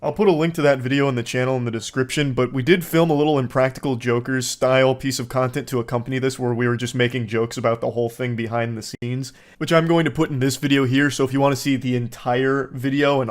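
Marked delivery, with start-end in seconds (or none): none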